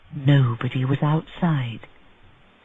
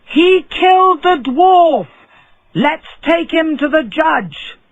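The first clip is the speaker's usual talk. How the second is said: crowd shout; 11 semitones higher, 5 dB louder than usual. A narrator's reading. People at windows shouting at the rich man, outraged. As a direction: cry it from the window.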